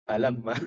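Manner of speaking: "Alam ma" dibaca menyambung seperti satu kata; salah baca. Retold or imitated. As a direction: read "Alam ma" as joined syllables, not letter names. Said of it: "bacanya tuh bukan alif lam mim". ("Alam ma" dibaca bersambung, bukan nama huruf)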